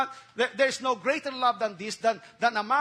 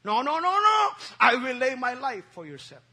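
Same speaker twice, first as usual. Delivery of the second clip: insistent Peter; 4 dB louder than usual. Acted. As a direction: protest hard, refuse to be told no.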